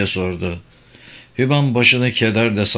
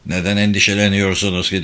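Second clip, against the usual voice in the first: ordinary delivery, nothing marked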